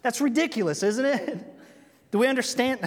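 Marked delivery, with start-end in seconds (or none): none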